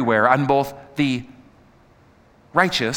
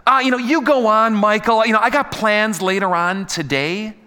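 put-on blasé kid brush-off; 5 semitones higher, 3 dB louder than usual. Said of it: "Does that kid go".

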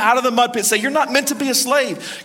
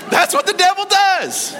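high, slow, emphatic shout over laughter; preaching shout; 10 semitones higher, 4 dB louder than usual. Real, not acted. Preacher telling the church about the devil, shouting. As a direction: drive the point home at full voice.

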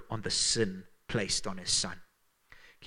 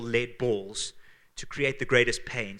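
usual speaking voice

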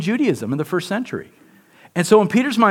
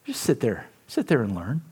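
soft, much quieter teasing command; hushed instruction; 6 semitones lower, 8 dB quieter than usual. Pretend. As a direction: tell him gently to sit down and listen. Telling Peter to sit quietly.

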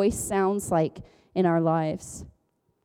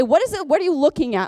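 higher, louder urgent questioning; lightly voiced father; 6 semitones higher, 4 dB louder, faster than usual. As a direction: lightly play a dad quizzing a lost caller. Acts father questioning lost caller.

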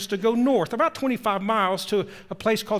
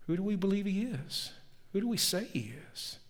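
softer, inward musing; quiet; 9 dB quieter than usual. A narrator's reading. Disciples quietly wonder who Jesus is, hushed and softer. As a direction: ask yourself under your breath. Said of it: "suddenly get very quiet".

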